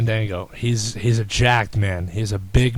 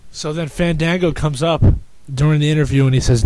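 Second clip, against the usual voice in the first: usual speaking voice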